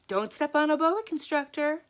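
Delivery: put-on high, loud excited girl's voice; a schoolgirl's squealed warning. A narrator's reading. Classmate calling out a farewell warning excitedly.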